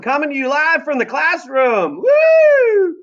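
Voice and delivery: high, slow announcer shout with a whoop; mock live broadcast. Real, not acted. Reader hamming up a live classroom broadcast, loud and excited. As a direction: hype it like a live show.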